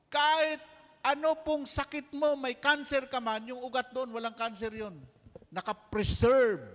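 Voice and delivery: high, loud, fervent sermon voice; rousing preaching. Acted. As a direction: lift the congregation up with it. Preacher rising to full force.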